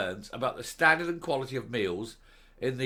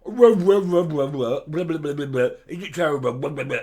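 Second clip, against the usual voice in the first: mocking blather; 6 dB louder than usual. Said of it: "Graham is sitting there going".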